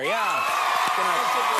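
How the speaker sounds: ordinary talk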